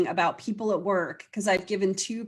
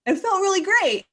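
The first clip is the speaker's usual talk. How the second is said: high, loud and delighted, voicing a relieved client; bright-eyed imitated joy, much higher and louder; excited client quote; 12 semitones higher, 7 dB louder than usual. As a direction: mimic a client's happy surprise. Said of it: "and then they're like".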